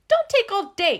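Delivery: very high, loud cross squeak; cross mouse. A narrator's reading. Mouse scolds stuck Gus, high cross squeak. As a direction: snap at him impatiently. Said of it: "he said crossly".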